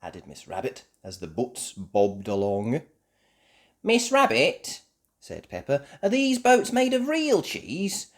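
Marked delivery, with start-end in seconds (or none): 3.8-8.1 curious Peppa. Acted. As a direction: ask wide-eyed. Peppa asks about boats.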